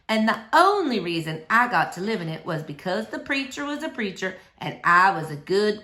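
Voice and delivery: ordinary delivery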